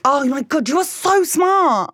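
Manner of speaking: big over-the-top compliment; gushing praise. Acted. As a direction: lay the admiration on thick, slowly. Mock-gushing admiration to a friend.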